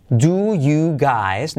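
DO, YOU, GUYS each drawn out; careful full "do you", not merged; separated words. Shown as a demonstration. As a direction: say "do you guys" very slowly, word by word. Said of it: "Así sería separado".

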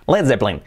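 "Led Zeppelin" as led ZEP-lin; voiced Z, middle E dropped in "Zeppelin"; native English pronunciation. Shown as a demonstration. Teacher showing how it really sounds, English pronunciation. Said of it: "Así suena".

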